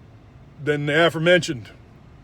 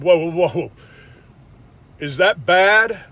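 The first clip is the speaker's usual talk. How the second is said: a slowed, louder, higher put-on "whoa, is that bad"; mock naive surprise; 3 semitones higher, 6 dB louder than usual. Patient told his heart is stopping, naive mock surprise. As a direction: play it dumbly surprised, drawn out. His own dazed reaction retold.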